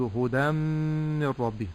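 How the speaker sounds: هدى من ربه with short hu-da and a long hummed m; merged tanween. Shown as a demonstration. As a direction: slowly merge هدى into the mīm of من with ghunnah. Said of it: "أضغمت التنوين في الميم". («هدى من ربه» بإدغام التنوين في الميم بغنّة)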